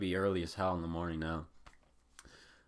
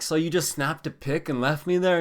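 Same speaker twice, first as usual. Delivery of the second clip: exasperated retort; 8 semitones higher, 7 dB louder than usual. Retold or imitated. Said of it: "I'm like".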